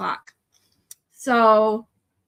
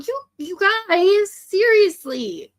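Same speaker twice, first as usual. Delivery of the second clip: choked up; 7 semitones higher, 4 dB louder, much slower than usual. Real, not acted. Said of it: "gotta stop crying on these streams".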